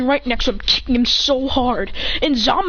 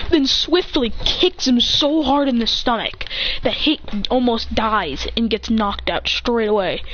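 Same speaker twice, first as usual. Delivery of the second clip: plain reading voice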